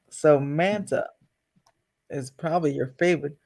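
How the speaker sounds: ordinary talk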